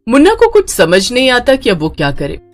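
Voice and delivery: ordinary delivery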